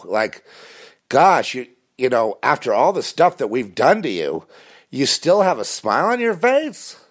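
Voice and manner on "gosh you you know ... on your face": high, incredulous imitation of a stranger; hammed-up disbelief, rising high at the end; mock astonishment. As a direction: marvel in a put-on high voice. Stranger marvels at the speaker.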